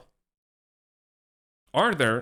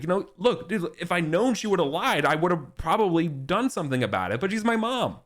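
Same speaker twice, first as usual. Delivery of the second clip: higher, a lightly voiced young man begging his uncle; pleading young knight; 9 semitones higher than usual. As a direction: plead with the angry king, half-hearted. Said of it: "Yvain's kinda pleading with him".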